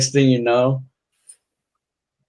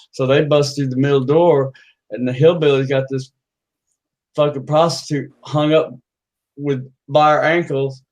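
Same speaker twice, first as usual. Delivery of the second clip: normal talking voice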